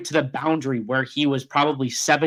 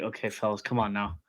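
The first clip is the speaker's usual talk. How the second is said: soft mock scolding; 5 semitones lower, 10 dB quieter than usual. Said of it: "and I was like".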